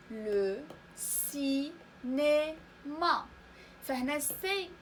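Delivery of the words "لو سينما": "لو سينما" very slow, each syllable separated; syllable-by-syllable model. Shown as a demonstration. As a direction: split "سينما" into si, né, ma with pauses. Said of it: "تنطق س حيت متبوعة". (نطق "لو سينما" مقطعًا مقطعًا ببطء شديد)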